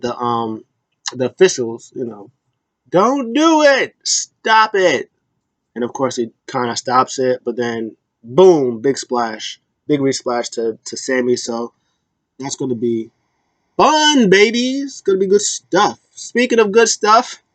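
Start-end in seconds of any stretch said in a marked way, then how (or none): 2.9-5.1 high, slow pleading shout of a referee; mock referee yell. Retelling officials yelling at the attacker, high drawn-out plea. 13.8-17.4 hyped up. Real, not acted. Vlogger hyping the coming match to viewers, high and excited.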